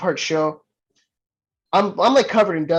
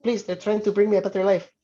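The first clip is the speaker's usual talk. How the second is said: 1 mock pleading; 4 semitones higher than usual. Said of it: "been like".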